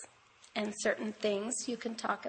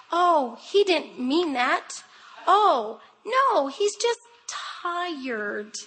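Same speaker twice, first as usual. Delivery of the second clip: higher, louder, slow cooing excuses; doting grandma excuses; 5 semitones higher, 6 dB louder, much slower than usual. Retold or imitated. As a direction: play the grandma who excuses everything. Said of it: "I give every excuse in the world".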